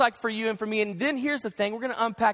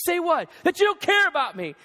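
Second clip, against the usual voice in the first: higher, louder back-and-forth; heated exchange; 6 semitones higher, 3 dB louder than usual. Retold or imitated. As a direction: argue it out, stung. Retelling a marital argument.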